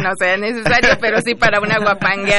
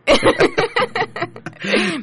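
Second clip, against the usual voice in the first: high burst of laughter; 13 semitones higher, 3 dB louder than usual. Real, not acted. Guest laughs at host's joke.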